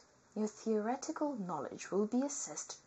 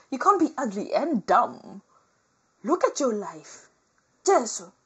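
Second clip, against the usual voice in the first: loud mock scolding; 3 semitones higher, 12 dB louder than usual. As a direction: scold them loudly, half-joking.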